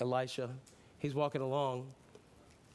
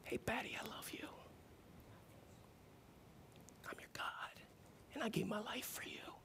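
God's whisper; 8 semitones higher, 14 dB quieter than usual. Pretend. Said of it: "God can just say".

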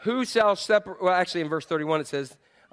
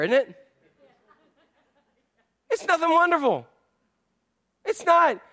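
ordinary delivery

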